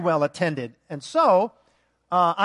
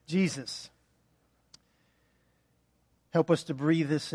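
quiet prayer; 5 semitones lower, 6 dB quieter than usual. Real, not acted. Pastor praying softly over the congregation, hushed prayer. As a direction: pray it gently, unhurried.

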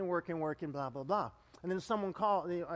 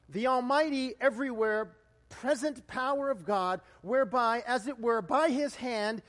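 raised, emphatic recitation; catechism reading; 5 semitones higher, 5 dB louder than usual. Acted. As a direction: proclaim it phrase by phrase from the pulpit. Reads the catechism aloud.

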